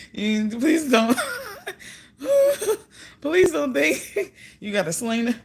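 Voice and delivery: pleading through laughter, high; laughing so hard it squeaks; laughing plea. Real, not acted. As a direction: beg him while cracking up. Laughing while pleading with him.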